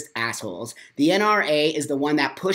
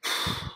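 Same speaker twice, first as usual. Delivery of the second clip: heavy sigh; 9 dB quieter than usual. Real, not acted. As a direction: blow out a breath after ranting. Catching breath after the rant.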